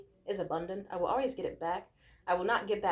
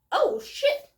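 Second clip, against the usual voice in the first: high, loud diva squeal; sassy squeal; 13 semitones higher, 5 dB louder than usual. Pretend. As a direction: shriek it like a drag diva. Squeal while spinning.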